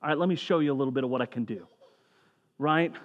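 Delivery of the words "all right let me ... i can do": quick, softer playful Jesus; tossing it off, playful and quick. Retold or imitated. Jesus teasing the woman at the well, quick and soft. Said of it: "this is Jesus going".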